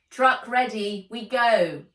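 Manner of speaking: loud call to go; shouting. A narrator's reading. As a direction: call everyone to the truck. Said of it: "shouted Altan".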